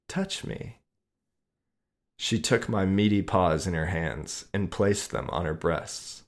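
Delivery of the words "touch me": high, soft and seductive; a soft high invitation. Acted.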